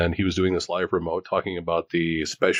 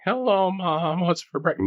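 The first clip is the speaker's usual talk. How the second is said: mock squeaky teenage voice, much higher; cracking teen voice; 12 semitones higher than usual. Teen greeting his mom at breakfast, voice cracking high. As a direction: squeak it out like a boy whose voice is breaking. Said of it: "It wasn't like".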